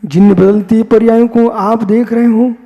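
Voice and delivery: ordinary talk, nothing marked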